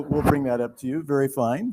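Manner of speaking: ordinary talk, nothing marked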